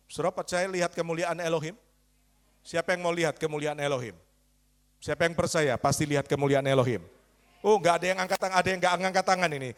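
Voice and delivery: usual speaking voice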